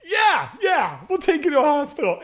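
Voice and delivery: quick, eager yes, almost laughing; eager agreement. Retold or imitated. Cab riders finally letting the bloodied man in, eager rushed yes.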